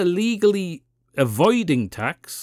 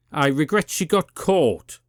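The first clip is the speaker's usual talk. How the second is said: normal talking voice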